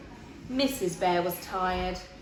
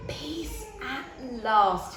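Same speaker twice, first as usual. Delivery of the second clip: slow, relieved peace at last; relieved sigh; 3 semitones higher, much slower than usual. Acted. Mister Bear finally in bed, slow relief. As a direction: settle in with relief, slowly. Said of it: "he said to himself".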